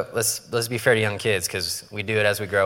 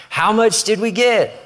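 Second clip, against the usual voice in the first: excited greed; 10 semitones higher, 5 dB louder than usual. Retold or imitated. Excited kid opening cards.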